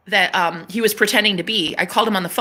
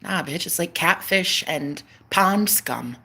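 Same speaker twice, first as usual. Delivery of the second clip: ordinary talk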